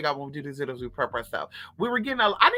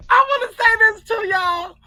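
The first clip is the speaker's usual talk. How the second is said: high, loud, laugh-choked voice; helpless laughing; 7 dB louder than usual. Real, not acted. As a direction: force it out through real laughter. Helpless laughter at own story.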